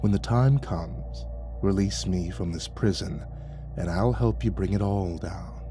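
low, soft dragon voice, hushed; an ancient beast sharing a secret plan; dragon confiding. Acted. Dragon secretly recruits the keeper, low and hushed.

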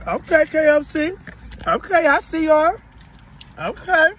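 high, loud approving okays; impressed. Real, not acted. Reviewer hyping KFC to viewers after a bite, impressed. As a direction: let each okay jump up, loud and impressed.

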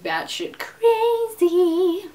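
slow, high put-on crazy voice; mock crazy voice. Vlogger joking about herself, slow hammed-up crazy voice. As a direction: ham up being unhinged.

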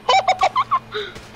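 short burst of high, squealing laughter; high staccato cackle; high-pitched laugh. Real, not acted. Laughing at friend's mishap.

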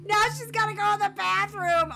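high, loud outburst; exasperated wail. Real, not acted. Exasperated at Sim's next need.

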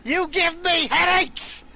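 high, loud, slow silly shout; mock shout. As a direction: drag it out as a loud squawk.

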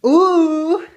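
very high, loud ooh; excited ooh. Real, not acted. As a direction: squeal with delight. Baby takes rice.

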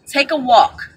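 ordinary delivery

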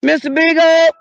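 loud high call for the dog. Acted. Searching outdoors for the dog, loud call. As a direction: shout the dog's name into the night.

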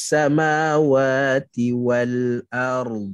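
very slow recitation, ard held; Quran recitation. Shown as a demonstration. Teacher recites the phrase as the final example. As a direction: chant it slowly and let ard linger.

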